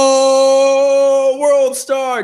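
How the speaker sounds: mock hype. Real, not acted. Mock hype at cohost's joke.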